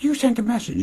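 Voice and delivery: very high mock accusation; Audrey. Host acting Audrey confronting Zoe, mock-accusing falsetto. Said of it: "Audrey's just like".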